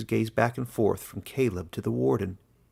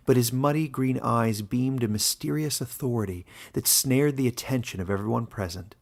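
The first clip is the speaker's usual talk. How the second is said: usual speaking voice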